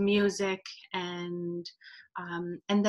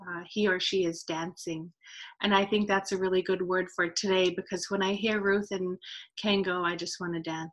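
ordinary talk

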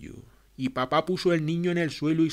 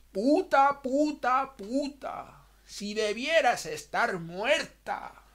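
high, spitting insult fading to slow contempt; insulting mother; 11 semitones higher, slower than usual. A narrator's reading. Mother curses her pregnant daughter, high then slow. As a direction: hurl the word, then curse her slowly. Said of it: "Y ella me dijo".

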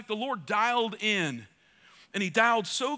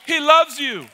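much louder and higher; preaching shout; 4 semitones higher, 10 dB louder, slower than usual. Acted. Preacher exhorting the congregation, full-force shout. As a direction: belt it at the church.

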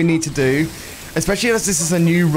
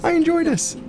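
much higher, squealing delight; giddy delight; 13 semitones higher, slower than usual. Real, not acted. Streamer answering chat about the speed, high giddy squeal. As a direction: gush with glee.

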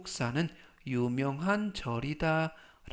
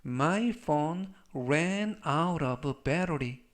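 very slow, measured words; slow model reading; much slower than usual. Shown as a demonstration. Teacher models the phone battery sentence for learners, slow model reading. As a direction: give every word time, as for beginners.

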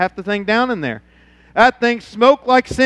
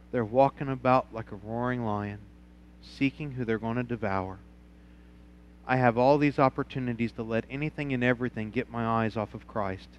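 hushed, intimate sermon voice, much softer; soft reflective preaching; 7 semitones lower, 13 dB quieter than usual. Acted. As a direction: draw them in quietly, like a confession.

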